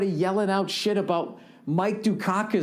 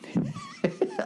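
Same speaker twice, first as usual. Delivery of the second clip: sudden high squeaky laugh; laughing at his own bit; 4 dB quieter than usual. Real, not acted. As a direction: burst into a high giggle. Laughs at himself.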